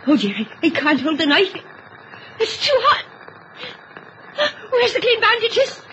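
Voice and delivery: high, tearful and frantic; a panicked wife who cannot hold the hot knife; rising panic. Acted. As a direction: scramble in fear, voice going up.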